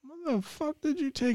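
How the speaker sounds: shocked and worried; alarmed. Retold or imitated. Seeing his pale friend in class, high alarmed. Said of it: "And I'm like".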